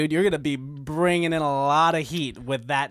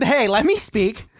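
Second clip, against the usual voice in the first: slow, loud "hey, let me speak"; insistent; 5 semitones higher, 4 dB louder, slower than usual. Real, not acted. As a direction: firmly claim your turn. Demanding the floor.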